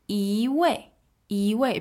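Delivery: 一 rising in second tone before 位. Shown as a demonstration. Teacher contrasting contexts of 一位, second tone on 一. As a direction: lift 一 upward, then let 位 fall. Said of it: "It becomes a second tone".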